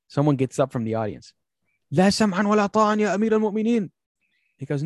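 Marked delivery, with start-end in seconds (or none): 1.9-3.9 high, loud angry Arabic retort. Retold or imitated. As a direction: shout back defiantly in Arabic. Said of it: "Like, he's mad".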